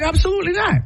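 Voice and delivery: high, firm refusal in a lightly put-on grandmother voice. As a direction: answer like a grandmother who won't budge. Mom's firm reply, voiced quote.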